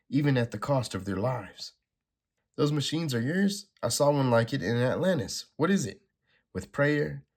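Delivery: lightly acted comic-book dialogue; dramatic comic reading. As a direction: read the panels like a dramatic script. Reads comic dialogue aloud.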